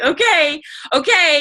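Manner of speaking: high, rushed okay okay; scrambling to obey; flustered. Retold or imitated. Retold shaky compliance.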